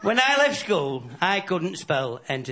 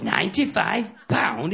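slow, disbelieving Ninety-five pound?; incredulous echo; 2 semitones higher, slower than usual. Acted. Smoker hearing the cigarette bill, slow disbelief. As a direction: drag out Ninety-five pound like you can't believe it. Pal repeats the price in disbelief.

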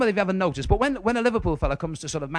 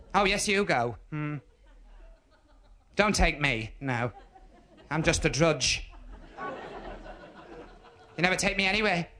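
nagging wife. Retold or imitated. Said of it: "and she starts".

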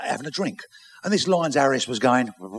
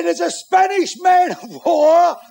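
high, drawn-out hollered report; lookout's shout; 8 dB louder, slower than usual. Acted. Lookout warning the captain of a Spanish warship, shouting. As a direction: bellow every word over a long distance.

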